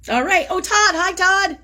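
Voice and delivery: light up greeting a friend; delighted shout-out to a viewer; excited greeting. Real, not acted.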